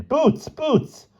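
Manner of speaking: high calling out a name. A narrator's reading. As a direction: call for him across the room. Calling his hidden friend.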